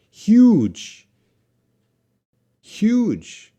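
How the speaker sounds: huge called out high and loud; teacher drills the word huge loudly; emphatic drill. Shown as a demonstration. Teacher drills the class, loud and high. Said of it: "pronunciation drill".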